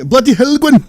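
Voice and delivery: high put-on Welsh; quick jokey Welsh catchphrase; exaggerated Welsh catchphrase; mock Welsh accent. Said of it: "do the Welsh".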